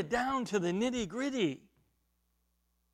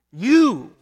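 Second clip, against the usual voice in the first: "You," said loud and high and hit hard; emphatic you; 4 semitones higher, 17 dB louder than usual. Shown as a demonstration. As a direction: punch "You," as a sudden call. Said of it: "is emphatic".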